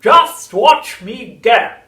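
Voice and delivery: high, loud, slow screechy chant; sing-song triumph of a crazed old crone; shouting old grandma. A narrator's reading. Grandma rising through the house, shrieking singsong. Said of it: "she called down".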